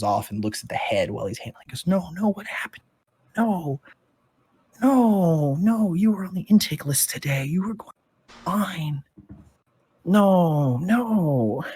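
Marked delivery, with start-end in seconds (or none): 1.9-11.6 high, drawn-out acted lament; grieving creature. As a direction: wail in disbelief over a lost companion. Creature mourns over the corpse.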